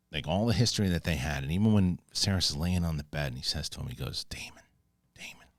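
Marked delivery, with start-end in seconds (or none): 4.2-5.5 hushed, feeble call from a sickbed; faint imitation of a bedridden king; weak whispered plea. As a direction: whisper the name, barely voiced. Dying king calls from bed.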